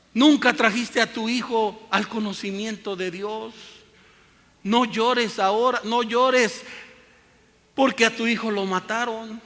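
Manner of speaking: don't cry now, you never taught him; stern loud preacher rebuke. Acted. Preacher rebukes a weeping mother.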